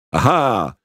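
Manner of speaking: high, teasing sneer; mocking. A narrator's reading. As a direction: taunt the boy who wants to switch sides. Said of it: "fit railleusement Thomas".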